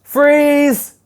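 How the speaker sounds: loud, high call to stop. Real, not acted. Teacher calling the reading class to freeze, loud. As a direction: shout it out to halt the class.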